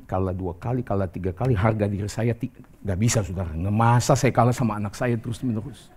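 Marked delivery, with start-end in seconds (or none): none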